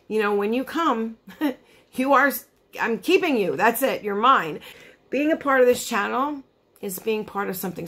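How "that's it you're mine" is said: ordinary delivery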